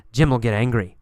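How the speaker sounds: ordinary talk, nothing marked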